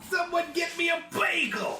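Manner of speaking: put-on high shouted New York accent; hammed-up New York yell. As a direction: yell like a cartoon New Yorker.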